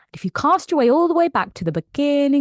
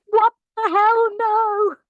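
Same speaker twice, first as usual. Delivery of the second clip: horrified friends; 11 semitones higher, slower than usual. Retold or imitated. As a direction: yell at the screen in mock horror. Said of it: "people who care about her are watching it like".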